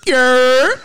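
incredulous. Real, not acted. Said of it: "what the fuck is".

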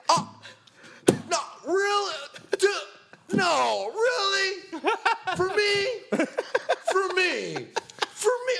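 very high, gushing mock surprise; imitating delighted Sean. Imitating Sean thrilled that a friend showed up, high and gushing. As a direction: squeal like the birthday boy who can't believe it. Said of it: "you were like".

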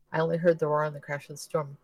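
ordinary delivery, nothing marked

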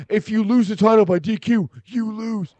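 mock Hollywood-ready voice; Johnny Laurinaitis. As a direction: lay down the rule in caricature. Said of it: "Hollywood ready voice".

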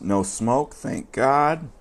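normal talking voice

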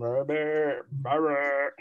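very low, crooning seducer. Retold or imitated. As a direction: drop deep and rumble it seductively.

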